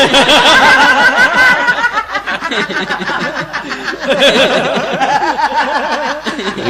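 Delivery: long high peal of laughter; cracking up at his own joke; big laugh. Real, not acted. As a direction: burst out laughing at the punchline. Teller laughs at own punchline.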